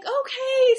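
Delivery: moved and delighted. Retold or imitated. Mom proud her daughter asked for help, overjoyed. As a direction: burst out with proud joy. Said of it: "I was like".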